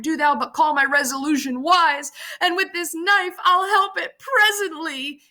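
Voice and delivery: high, urgent acted plea; desperate plea. Juliet begs the friar, knife in hand, high and desperate. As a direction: pitch it high and build to the threat.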